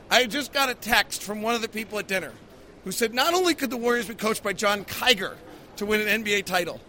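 usual speaking voice

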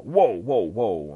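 loud, rising whoas falling away; loud acted protest; alarmed interjection. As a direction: call out to halt someone.

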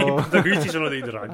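excited joke. Acted. Joking exaggeration, laughing.

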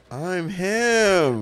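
loud, drawn-out high boast; cracked high voice. Acted. Buddy copying the Top Boy bit, loud high cracked voice. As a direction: push it up high, imitating the gangster squeal. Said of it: "when to crack your voice".

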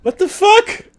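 very high, loud yelp of disbelief; play-acted outraged shout; mock outrage. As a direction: cry out in hammed-up shock. Acted outraged reaction to challenge.

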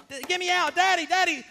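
high, loud yelling for Daddy; imitated child's panic. Son behind bathroom door calling Daddy, mock-panicked yelling. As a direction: yell like a frantic trapped boy. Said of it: "by the door, it's more".

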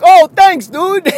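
high, loud mock gratitude; joking fan. Guy in the row pretending to pocket the money, loud mock thanks. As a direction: imitate a jokester thanking someone. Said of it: "there was always one guy".

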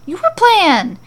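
loud, high, bewildered yell back; yelling back in confusion; confused yell. Acted. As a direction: shout it back, just as lost. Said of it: "he yells back with fairly equal confusion".

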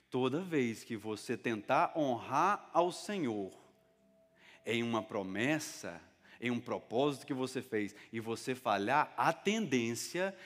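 slow, deliberate preaching; measured pace with drawn-out phrases. Real, not acted. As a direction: spell the point out slowly so they understand. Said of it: "Vou falar bem devagar pra você entender".

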